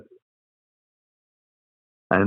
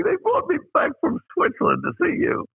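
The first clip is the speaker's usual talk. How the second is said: retold incredulous outburst, pitched way up; laughing disbelief. Director laughing at the unknown actor, high mock disbelief. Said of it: "got up and started laughing".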